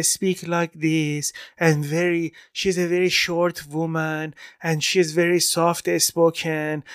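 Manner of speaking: put-on slow, careful Iranian-accented speech. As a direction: speak slowly in your Iranian mother's accent. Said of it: "my mom is very Iranian".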